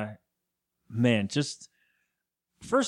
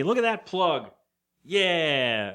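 ordinary talk

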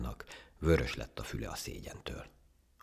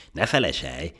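pompous; 3 semitones higher, 7 dB louder than usual. Acted. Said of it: "legyintett nagyképűen a majom".

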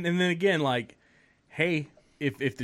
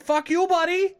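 very high mock heckle with a rising end; comic high-voiced retort; imitated Canadian heckler; 14 semitones higher, 4 dB louder than usual. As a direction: play the irate passerby, pitched way up. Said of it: "He's like".

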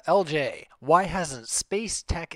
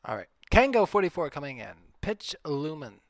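ordinary talk, nothing marked

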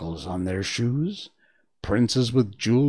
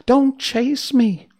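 high, loud, slow frightened plea; frightened little voice, slower; 12 semitones higher, 7 dB louder, slower than usual. Acted. China princess pleading again as Dorothy chases her, frightened and slow. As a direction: stretch the plea out in fear. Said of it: "She had such a frightened little voice".

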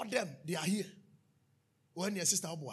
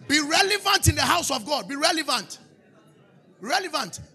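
much higher, loud chanting shout; preaching shout; 8 semitones higher, 11 dB louder than usual. Real, not acted. Preacher chanting to the church over music, loud.

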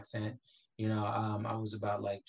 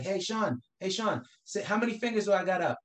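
high-pitched schoolkid egging someone on; mock-eager kid setting up a tease; teasing kids; 8 semitones higher, 4 dB louder than usual. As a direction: imitate a pestering classmate, voice pitched up. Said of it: "people come up to me like".

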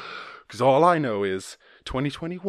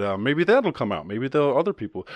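normal talking voice

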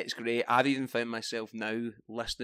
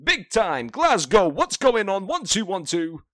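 high, loud hyped MC patter; garage MC voice; 8 semitones higher, 7 dB louder than usual. Pretend. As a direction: hype the crowd like a grime MC. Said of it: "What else can I say?".

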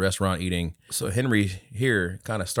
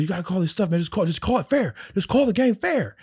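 higher pleading dad voice; complaining dad; 11 semitones higher than usual. Retold or imitated. Soccer dad arguing with the ref, higher voice. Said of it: "he's like".

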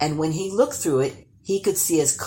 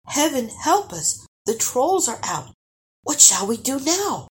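high, alarmed boy's voice, fully voiced; a fearful plea, pitched high; scared and high, only hinting at a whisper; frightened whisper; 9 semitones higher than usual. A narrator's reading. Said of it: "whispered Eric".

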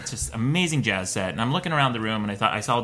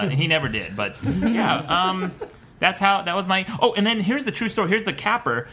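usual speaking voice

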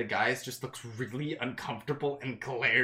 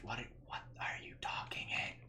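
whisper; 12 dB quieter than usual. Acted. Companion leans in, whispers question.

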